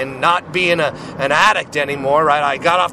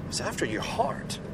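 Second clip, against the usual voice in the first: He's after your heart, much softer, almost under the breath; hushed repeat; 12 dB quieter than usual. Real, not acted. Preacher softly to his listeners, hushed. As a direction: drop right down and let it land.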